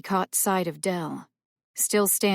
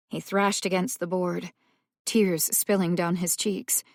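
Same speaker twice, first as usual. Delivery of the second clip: ordinary delivery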